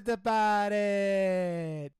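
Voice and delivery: loud, stretched-out Italian-American drawl; milk every syllable, Italian-American style; exaggerated Italian-American catchphrase. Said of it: "Italian hand gesture at the end".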